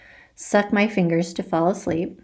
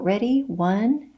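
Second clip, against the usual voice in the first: usual speaking voice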